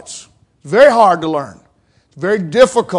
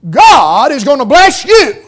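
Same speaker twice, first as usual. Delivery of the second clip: higher, louder mock prosperity preaching; imitated preacher's promise; 4 semitones higher, 6 dB louder than usual. Mimicking feel-good preachers promising a blessing, loud and emphatic. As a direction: proclaim it big, punching each word like a preacher. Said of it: "heard them say".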